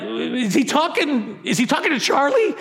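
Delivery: amused self-mocking disbelief; laughing mock denial. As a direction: pretend he can't mean you, laughing. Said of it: "you're like".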